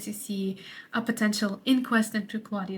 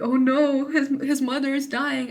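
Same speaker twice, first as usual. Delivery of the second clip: mock-alarmed gasp of realization, raised pitch; alarmed audience reaction; 4 semitones higher, 4 dB louder than usual. Audience watching Gertrude die, mock alarm. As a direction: play a louder, higher "oh no" of dismay. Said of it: "focus on Gertrude and think".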